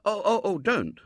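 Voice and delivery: put-on stammering, high, flustered boy; greatly distressed. A narrator's reading. Boy upset by a girl crying, distressed stammer. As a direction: plead in distress, tripping over the first sound. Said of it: "greatly distressed".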